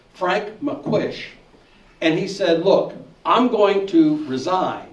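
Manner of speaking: ordinary talk, nothing marked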